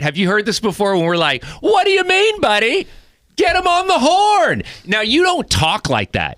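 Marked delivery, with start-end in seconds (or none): 1.6-4.6 very high, louder mock boss voice; squeaky cartoon impression of his producer; J Rye voice. Host mimics his producer for laughs, very high and loud. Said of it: "my version of J Rye voice".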